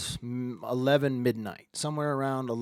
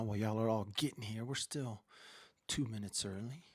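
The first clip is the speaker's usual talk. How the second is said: unvoiced aside whisper; 5 semitones lower, 11 dB quieter than usual. Host telling early viewers the time, whispering. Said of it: "You like my whisper?".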